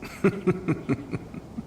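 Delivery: high breathy chuckle; light amused laugh. Acted. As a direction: laugh at the riddle you just set. Laughs after his question.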